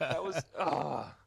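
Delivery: laughing. Real, not acted. Laughing at botched outro.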